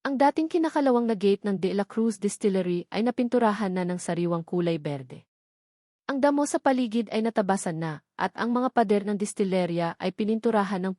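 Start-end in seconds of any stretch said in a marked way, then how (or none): none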